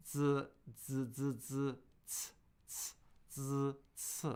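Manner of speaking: isolated "zi" and "ts" sounds; teacher isolating "zi" and "ts"; zi and ts demo. Shown as a demonstration. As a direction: show "zi" against "ts", sound by sound. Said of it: "recommendations for pronunciation of zi and ts".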